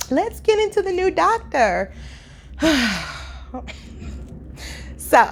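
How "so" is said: usual speaking voice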